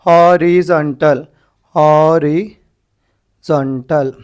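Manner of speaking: "Horizontal" drawn out, broken into ho-ri, zon-tal; slow dictation. Shown as a demonstration. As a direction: dictate "horizontal" slowly, part by part. Teacher dictates word in parts. ("Horizontal" धीरे, टुकड़ों में)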